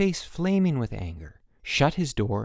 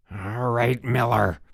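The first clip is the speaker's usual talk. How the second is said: through clenched teeth; slower than usual. A narrator's reading. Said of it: "through clenched teeth".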